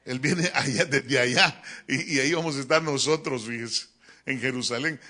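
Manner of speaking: ordinary talk, nothing marked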